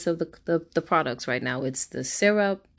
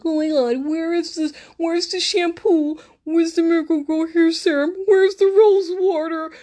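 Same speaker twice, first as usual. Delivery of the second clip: much higher and louder, frantic mock; hammed-up frantic shriek; panicking family; 13 semitones higher, 7 dB louder than usual. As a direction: play the family losing it over missing products. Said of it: "They're like".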